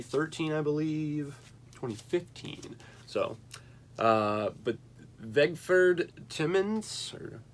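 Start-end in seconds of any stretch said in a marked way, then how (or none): 6.3-7.1 Timmons with short i and weak last vowel; tentative pronunciation. Shown as a demonstration. Reviewer guessing at an Italian name, TIM-unz for Timmons. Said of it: "trying to think how I pronounce".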